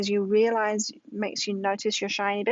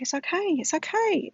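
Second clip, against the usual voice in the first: high-pitched soothing parent voice; retold reassurance; 9 semitones higher than usual. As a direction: soothe an upset child, pitched well up. Parent reassuring upset son.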